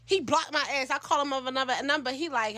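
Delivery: plain reading voice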